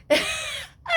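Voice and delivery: very high burst of laughter. Real, not acted. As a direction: break into a squealing laugh. (éclat de rire très aigu)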